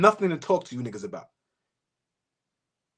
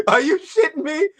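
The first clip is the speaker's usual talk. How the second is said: laughing; 7 semitones higher than usual. Real, not acted. Laughing disbelief.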